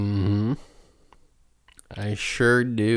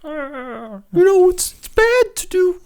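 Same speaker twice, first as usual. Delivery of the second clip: very high, loud puppet frog voice; Kermit voice; 7 dB louder than usual. Pretend. Mocking a Kermit voice, very high and loud. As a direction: squeak it out like Kermit. Mock voice after Kermit talk.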